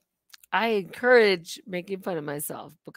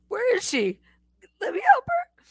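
higher, crying-laugh voice of a worried dog; 13 semitones higher, 3 dB louder than usual. Retold or imitated. Telling viewers how her dog fretted, high laughing dog voice. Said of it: "my dog going".

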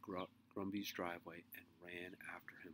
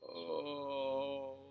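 long groaned oh, pitched up; dismayed groan; 6 semitones higher, 5 dB louder than usual. A narrator's reading. Henry seeing the meat across the rose bushes, dismayed groan. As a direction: groan it out, louder and drawn. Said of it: "and groaned at what he saw".

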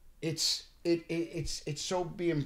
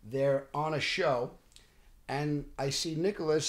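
normal talking voice

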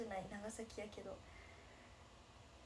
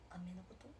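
whisper; 6 semitones lower, 9 dB quieter than usual. Real, not acted. Asking about a word.

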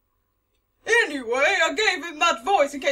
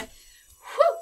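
high, loud relieved whew; 9 semitones higher, 6 dB louder than usual. Real, not acted. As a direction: blow out the relief. Relief after a scare.

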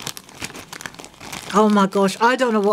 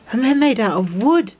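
normal talking voice

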